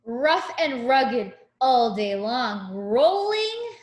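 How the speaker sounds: sing-song picture book rhyme; reading to a child. Acted. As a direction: read it like a bedtime rhyme.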